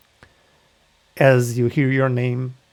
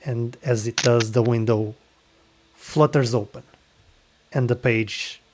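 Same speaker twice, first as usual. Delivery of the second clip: game master narration. Acted. Game master setting the scene for the player.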